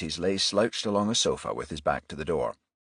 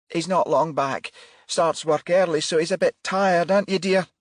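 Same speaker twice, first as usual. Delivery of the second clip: fussing mother; 12 semitones higher, 6 dB louder than usual. Acted. Mother covering for son.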